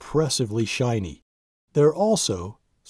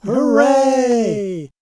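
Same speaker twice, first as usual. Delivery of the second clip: a loud, much higher cheer; big cheer; 10 semitones higher, 5 dB louder than usual. Acted. The crew cheering Donald's work, loud and much higher. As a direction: cheer like the whole crew won. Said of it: "they all let out a big cheer".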